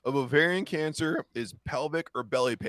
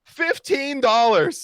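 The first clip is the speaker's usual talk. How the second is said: slow, high and loud hype call; excited announcement; 12 semitones higher, 10 dB louder, slower than usual. Real, not acted. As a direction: draw it out like a prize reveal. Announcing donation amount excitedly.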